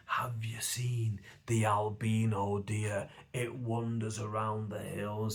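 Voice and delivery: slow, hushed legend-telling whisper. Retold or imitated. Bolton folk passing on an old wives' tale, slow whisper. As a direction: whisper it slowly like a spooky rumour. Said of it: "People would whisper in the eighties".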